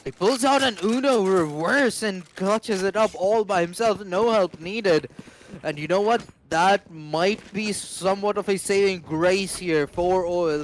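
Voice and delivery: loud, high hype commentary; esports caster. Acted. Co-caster hyping the clutch.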